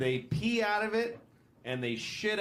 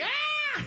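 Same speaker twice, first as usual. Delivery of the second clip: sudden high scream to show a scared man; a lion showing what a frightened person sounds like, with a sudden high scream; scary scream. Acted. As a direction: let out a high startled yell. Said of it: "that's what he looks like".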